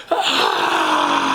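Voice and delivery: low strangled phantom wail; anguished cry. A narrator's reading. Phantom howls at his failed song, a low anguished cry. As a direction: cry out in frustration. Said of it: "he goes".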